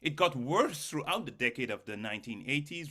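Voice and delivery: ordinary talk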